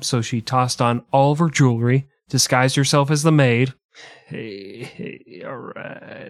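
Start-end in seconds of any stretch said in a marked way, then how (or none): 4.3-6.3 breathy unvoiced whisper; whispered aside. Real, not acted. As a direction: whisper it under your breath. Soft aside, near silent.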